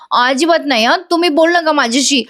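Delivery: high-pitched, lightly acted sulk; sulky refusal. A narrator's reading. Hurt wife to the husband who sent her away, mild sulk. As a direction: pout and refuse to make up.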